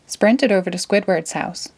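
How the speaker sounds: plain reading voice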